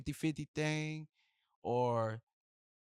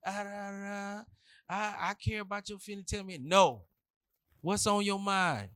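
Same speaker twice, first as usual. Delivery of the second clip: mimicking a caring woman; 6 semitones higher than usual. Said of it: "and she says".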